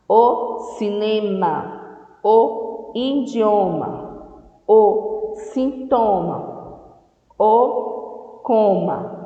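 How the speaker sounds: slow, deliberate word list. Shown as a demonstration. Teacher drills children on words, slowly. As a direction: spell each word out for kids. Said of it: "teacher lists words slowly".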